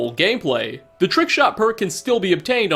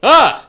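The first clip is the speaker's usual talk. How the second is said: sudden loud shout to scare; startling boo; 9 semitones higher, 11 dB louder than usual. Pretend. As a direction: jump out and yell Ah. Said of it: "Did I scare you this time?".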